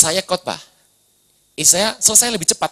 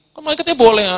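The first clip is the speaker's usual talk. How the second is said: put-on child protest; 7 semitones higher, 6 dB louder, faster than usual. Daughter protesting to mother.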